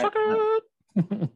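very high, drawn-out squeal; mock-triumphant high-pitched cry; squealed taunt. Same taunt repeated as mock cry.

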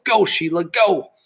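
high-pitched cheering yell. A narrator's reading. Marty yelling at Sheila as she swims, urgent yell. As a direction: yell her onward. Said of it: "he yelled".

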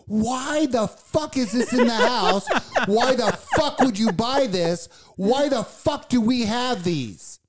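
angry yelling at the chips; screaming. Retold or imitated. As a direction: yell it in pained outrage. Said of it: "I'm screaming".

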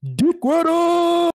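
high, slow, loud shout; playful shout. Real, not acted. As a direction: yell it at the fan teasingly. Mock accusing the fan.

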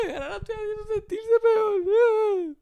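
high, loud, hammed-up blubbering whine; mock sobbing dad. Son imitating his dad crying over mom's broken wrist, mock sob. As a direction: wail it like a melodramatic crybaby father.

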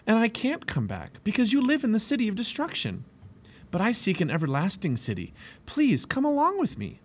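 high, earnest refusal turning to plea; insist, then beg in a high voice; earnest pleading. A narrator's reading.

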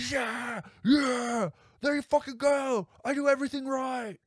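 high, loud mock screaming; screaming Nicolas Cage. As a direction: imitate a crazed actor hollering a rant.

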